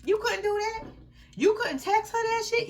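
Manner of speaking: high, loud disbelief; outraged. Real, not acted. Ranting at boyfriend's selfishness.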